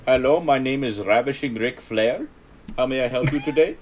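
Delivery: high, loud mock Indian call-centre accent. Joking as a call-centre rep named Ravishing Ric Flair, mock Indian accent. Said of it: "how's your Indian accent?".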